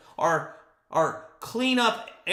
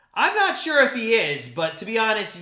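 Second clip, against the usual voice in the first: ordinary talk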